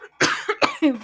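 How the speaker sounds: rough cough mid-laugh; coughing fit. Real, not acted. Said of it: "entre risa y tos".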